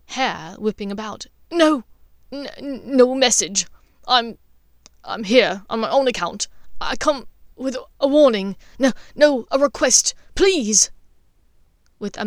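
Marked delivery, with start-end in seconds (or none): 1.5-10.9 louder, stammering, desperate plea; nervous stammer. A narrator's reading. Snape stammering his desperate request to Dumbledore, louder. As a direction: trip over the words in panic. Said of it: "a tangle of nervous energy".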